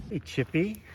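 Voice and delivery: high sing-song voice for the dog; squeaky praising a dog. Acted. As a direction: coo at the puppy. Talking to the dog.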